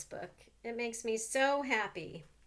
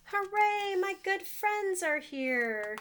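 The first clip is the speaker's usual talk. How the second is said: hooray, much higher and louder, then slower; excited cheer; 9 semitones higher, 6 dB louder, slower than usual. Acted. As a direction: cheer with joy as friends walk in. Amos cheers as friends arrive.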